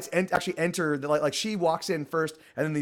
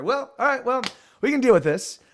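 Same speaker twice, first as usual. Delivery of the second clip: brisk take-charge; 9 semitones higher, 6 dB louder than usual. Acted. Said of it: "Torona's like".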